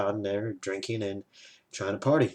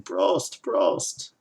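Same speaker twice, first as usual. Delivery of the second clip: imitated drinking toast; 9 semitones higher, 3 dB louder than usual. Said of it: "try and resolve it by saying".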